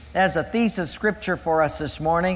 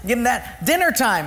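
usual speaking voice

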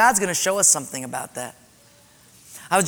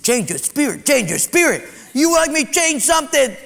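higher, scornful repeat; incredulous retort; 7 semitones higher, 4 dB louder than usual. Retold or imitated. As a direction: throw the words back at the pastor. Man mocking the advice.

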